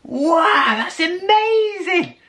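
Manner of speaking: high, slow, wide-eyed wow; mock amazement. Narrator pretending awe at the fisherman's tale. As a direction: overplay being impressed. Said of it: "And I was like".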